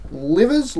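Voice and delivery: "livres" said like English "livers"; guessed pronunciation. Real, not acted. Vlogger guessing at a French currency, "livres" as LIV-erz. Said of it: "however you say that".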